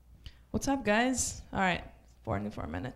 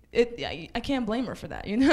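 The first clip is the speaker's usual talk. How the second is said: usual speaking voice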